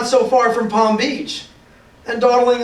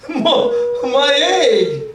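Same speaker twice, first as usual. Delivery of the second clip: high, surprised cry of the elephant; a hint of Horton's delighted alarm; excited shout; 5 semitones higher, much slower than usual. A narrator's reading. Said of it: "shouted Horton".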